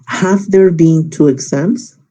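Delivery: slow and deliberate, a question rise on exams; careful slow classroom example; slow model question. Shown as a demonstration. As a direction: read it out slowly to the class. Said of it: "Puedo decir".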